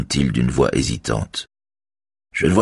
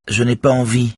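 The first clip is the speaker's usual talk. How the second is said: ordinary delivery, nothing marked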